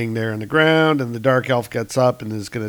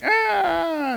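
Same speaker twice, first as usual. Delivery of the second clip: mock scream. Dark elf tackled by Thor, high mock scream. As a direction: squeal as if being tackled.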